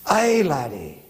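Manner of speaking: lightly hammed Scottish accent; mock Scottish accent. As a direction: toss off a jokey Scottish "Aye, laddie". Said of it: "Is that Scottish or Irish?".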